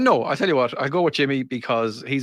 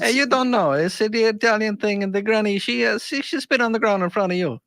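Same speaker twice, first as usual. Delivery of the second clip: higher-pitched put-on Italian accent; 6 semitones higher than usual. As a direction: explain it in a mock Italian accent. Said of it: "If they were Italian grannies".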